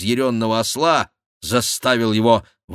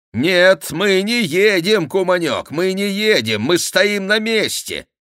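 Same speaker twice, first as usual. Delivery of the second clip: high, raised voice of the Gascon; contradicting rider; 10 semitones higher, 4 dB louder than usual. A narrator's reading. Chicot mockingly contradicts the monk. (насмешливое возражение, высоко и громче)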